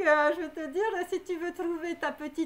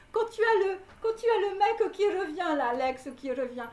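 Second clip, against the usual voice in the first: higher and louder, building into laughter; amused, raised-voice warning with a laugh; excited rising laugh; 6 semitones higher, 4 dB louder than usual. Real, not acted. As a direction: get carried away as the exes pile up. Warning about exes coming back.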